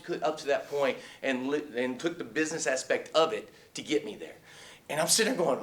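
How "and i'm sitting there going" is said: normal talking voice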